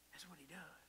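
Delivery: whispering That's what he does; hushed aside to the congregation; whispered repeat. Shown as a demonstration. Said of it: "repeated as a whisper".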